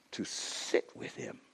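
fading into a whisper. Acted. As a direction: let the voice die to a hush at the end. Sermon closes on whispered key word.

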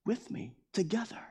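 David softly inviting friends to magnify God with him; soft cheerful call. Retold or imitated. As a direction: draw them in quietly. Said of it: "He's like".

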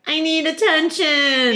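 high, drawn-out whine; whining. Retold or imitated. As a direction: whine it like a needy grown man. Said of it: "Kai was like".

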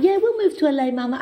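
put-on child's voice. Family voiced in her story.

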